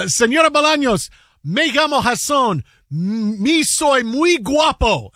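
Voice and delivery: high, drawn-out show-off Spanish; slow, hammed-up self-flattery; theatrical introduction. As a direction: present yourself grandly, stretching the last phrase. Acts out Spanish introduction on phone.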